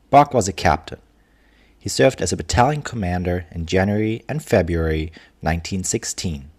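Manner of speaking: ordinary delivery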